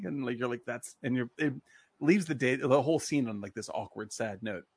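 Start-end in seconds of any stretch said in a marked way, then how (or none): none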